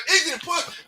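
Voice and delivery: ordinary talk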